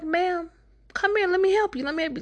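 high, sweet mock coaxing. Jasmine coaxes the old lady to come with her in a high sweet voice. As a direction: coax her gently like a lost grandma.

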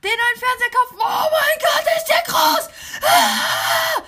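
building to a high, loud scream; high screaming YouTuber hype; hyped-up shriek. Acted. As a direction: shriek with over-the-top excitement. Hyped celebration of a big TV.

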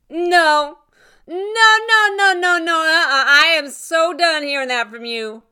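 loud, high string of refusals, easing down at the end; angry refusal. Acted. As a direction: cut off his pleading, fed up. Rose rejects ex's pleading, acted.

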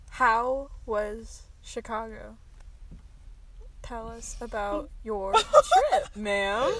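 slow, halting interview question; drawn-out question, thinking mid-sentence. Real, not acted. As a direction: drag out each word as you think. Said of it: "Why are you saying it so slow?".

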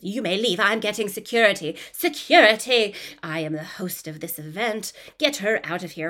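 put-on haughty English accent rising to a loud call of security; grand English lady ordering guests out; Dowager Countess English accent. [name] at dinner calling for security in a haughty English accent. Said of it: "the Dowager Countess on everyone".

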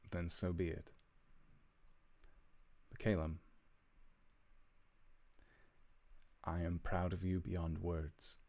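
a hint of a proud, tender father saying goodbye; gently voiced father, understated; lightly voiced loving father. Father says farewell to his son Calum, lightly voiced and tender.

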